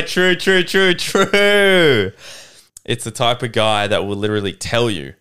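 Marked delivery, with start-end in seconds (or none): 0.0-2.1 high, loud drawn-out hooting; emphatic agreement. Real, not acted. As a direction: whoop in delighted agreement. Loud agreement with co-host's joke.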